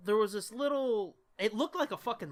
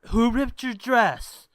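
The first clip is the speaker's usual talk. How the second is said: higher, louder, slow bewildered question; mock drunk boyfriend; 4 semitones higher, 9 dB louder, slower than usual. Drunk boyfriend asking girlfriend who ripped her dress. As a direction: ask it like a confused drunk.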